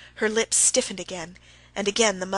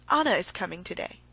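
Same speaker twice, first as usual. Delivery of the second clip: usual speaking voice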